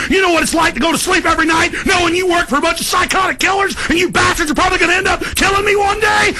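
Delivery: high, shouting angry tirade; furious rant. Real, not acted. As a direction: rage at them, building. Escalating rant at the system.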